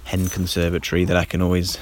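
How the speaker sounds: ordinary talk, nothing marked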